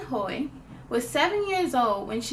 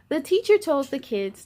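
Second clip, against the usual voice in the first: ordinary delivery, nothing marked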